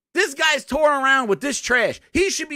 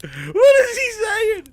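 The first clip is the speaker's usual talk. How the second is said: high, slow, laughing cry of disbelief; 11 semitones higher, 5 dB louder, slower than usual. Real, not acted. Overwhelmed by the reveal, laughing high and slow.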